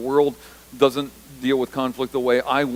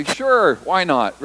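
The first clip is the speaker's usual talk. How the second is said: casual eager agreement; 4 semitones higher, 5 dB louder, much slower than usual. Acted. Disciples answering Jesus, acted.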